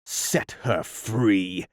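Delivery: slow captain's order; Hook's command. Acted. Peter commanding the pirates in Hook's voice. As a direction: draw it out like a stern pirate captain.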